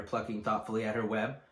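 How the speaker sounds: usual speaking voice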